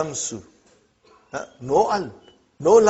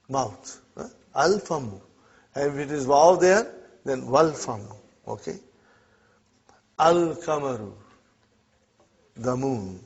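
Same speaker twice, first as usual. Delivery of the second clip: normal talking voice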